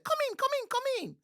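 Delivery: very high, loud, squealing monkey cry; thrilled little monkey spotting a visitor; excited monkey. A narrator's reading. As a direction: shriek with delight like a little monkey. Monkeys inviting him in.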